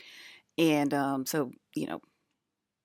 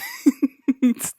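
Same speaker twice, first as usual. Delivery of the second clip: high laugh; 10 semitones higher, 9 dB louder than usual. Real, not acted. Laughing mid-sentence.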